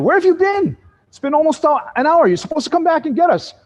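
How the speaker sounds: high-pitched scolding tourists; hammed-up impatient demand from a waiting group; indignant complaint.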